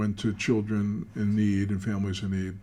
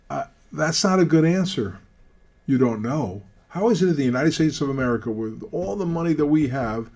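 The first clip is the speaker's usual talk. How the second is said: higher and louder, pressing hard; heated challenge; 5 semitones higher, 5 dB louder than usual. Retold or imitated. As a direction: call him out, indignant. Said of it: "And I said to him".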